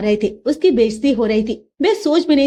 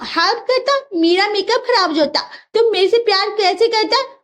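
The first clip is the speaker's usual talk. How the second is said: higher, sing-song broken Hindi; foreign bride voice; 7 semitones higher than usual. Acted. Russian bride teases her mother-in-law in broken Hindi.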